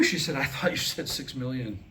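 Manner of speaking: usual speaking voice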